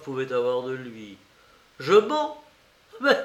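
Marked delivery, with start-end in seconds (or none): 1.8-3.2 high, rising incredulous question; pitched up in playful disbelief; mock disbelief. A narrator's reading. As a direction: throw it back as an astonished question. Porphyre repeats the accusation, questioning it.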